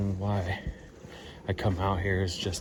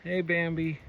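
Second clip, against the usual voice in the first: high playful call; 7 semitones higher than usual. Real, not acted. Waving to the deer.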